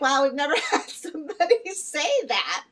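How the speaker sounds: high, amused mock voice with a laugh; laughing care team. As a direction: quote them laughing in disbelief. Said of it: "they're laughing 'cause they're like".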